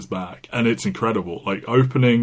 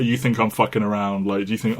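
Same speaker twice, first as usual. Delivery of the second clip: ordinary delivery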